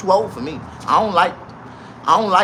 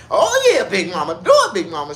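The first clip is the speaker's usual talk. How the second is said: high, loud hype-man cheer; hammed-up excited whoop; 1 mock cheering; 5 semitones higher, 4 dB louder than usual. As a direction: egg her on like at a strip club. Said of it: "And I was like".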